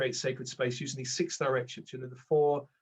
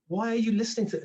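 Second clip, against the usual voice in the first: higher, mock-incredulous inner voice; nagging inner ego; 7 semitones higher, 4 dB louder than usual. Ego scolding him for his spiritual path, higher and incredulous. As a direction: needle yourself like a sceptical ego. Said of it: "I remember my ego at the beginning go".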